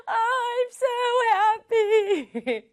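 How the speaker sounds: high voice, I'm so happy; happy tears in a child's voice; 감격한 여동생; tearful happy little sister. Acted. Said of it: "눈물을 보였죠".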